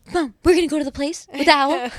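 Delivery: high, loud eager voice; excited kid. Retold or imitated. Mom voicing her son excited about camping. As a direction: jump in thrilled like a little boy. Excited child overhearing plans.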